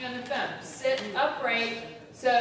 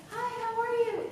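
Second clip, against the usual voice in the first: mock little girl, pitched up; little-girl phone voice; 8 semitones higher than usual. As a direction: imitate a colleague's girlish phone voice. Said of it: "they would talk like a little girl".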